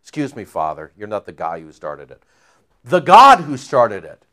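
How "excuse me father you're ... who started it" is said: much lower, softer correction; mock apology to God; quiet aside. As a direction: murmur it as a sheepish aside.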